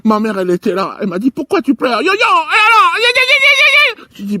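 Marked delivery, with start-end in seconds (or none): 1.3-3.8 much higher, loud crying yells; a mother screeching over her son's tears; hammed-up shrieking mother; mother wailing. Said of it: "tu me fais mal aux oreilles".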